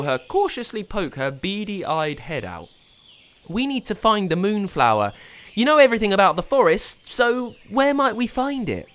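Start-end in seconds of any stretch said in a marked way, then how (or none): 3.5-8.9 high, louder, fast eager plea; excited pleading boy. A narrator's reading. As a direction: rush the question out. Pablo pleads with Wanda.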